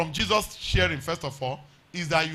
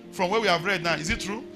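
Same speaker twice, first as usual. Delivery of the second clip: raised, ringing preacher voice over music; hollering revival preacher; 3 semitones higher than usual. Acted. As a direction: preach it out, climbing higher.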